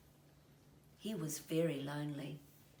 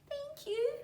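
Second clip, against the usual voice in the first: very high small voice; little kiwi voice. A narrator's reading. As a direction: thank her shyly like a tiny bird. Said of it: "said the little Kiwi".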